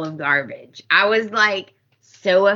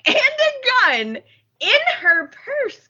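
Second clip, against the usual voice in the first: incredulous outrage; 12 semitones higher than usual. Real, not acted. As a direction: wail the shocking detail at your listeners. Outraged at premeditated crime.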